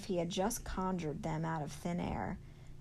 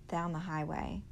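plain reading voice

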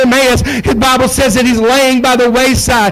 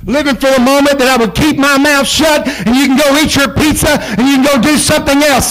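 ordinary talk, nothing marked

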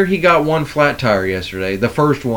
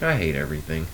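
muttered despair; 10 semitones lower, 11 dB quieter, faster than usual. Retold or imitated. Fed up at work.

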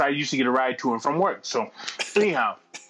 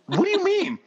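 baffled doctor. Retold or imitated.

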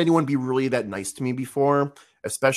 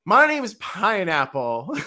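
high slow shy voice; 8 semitones higher, slower than usual. Retold or imitated. His own retold answer.

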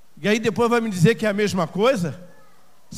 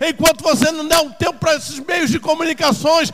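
loud, high impassioned sermon; urgent raised-voice exhortation; full-force preaching; 6 semitones higher, 9 dB louder, slower than usual. Acted. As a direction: preach at a shout, driving each phrase home.

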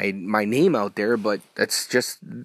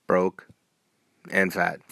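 normal talking voice

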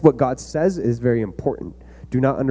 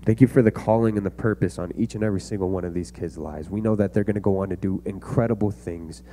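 soft, earnest prayer voice, sinking quieter; closing prayer; 2 semitones lower, 6 dB quieter than usual. Acted. Pastor prays over the kids, soft and earnest. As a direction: pray them out gently, like speaking to a close father.

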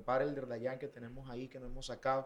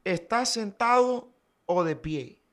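high, slow, drawn-out question; manager announcing news; 7 semitones higher, 4 dB louder, slower than usual. Retold or imitated. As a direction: imitate someone building suspense before big news. Voices manager calling with big news.

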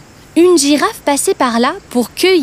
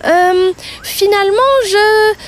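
higher, faltering bird backing out; hesitant bird; 6 semitones higher than usual. Acted. Bird losing nerve before the crocodile's teeth, hesitant. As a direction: hesitate and trail off. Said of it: "hésita un instant".

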